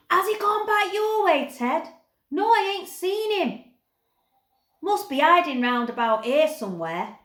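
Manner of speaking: put-on high, loud rough-boy shouts across the dump; rough boys calling out. A narrator's reading. Snaggart boys calling to each other while hunting Barney, loud rough shouts. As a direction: bellow it like a scruffy kid hunting someone. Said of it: "the Snaggarts calling to each other".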